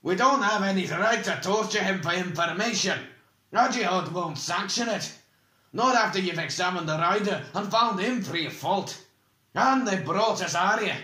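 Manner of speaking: high, loud, heated objection; indignant argument. A narrator's reading. Oric objecting to torturing the prisoner, indignantly. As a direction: argue forcefully against an injustice. Dwarf argues against torture.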